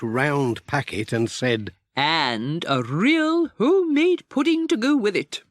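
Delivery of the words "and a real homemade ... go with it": much higher, prim lady's voice; Miss Hubbard. A narrator's reading. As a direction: offer it high and pleased, a fussy lady.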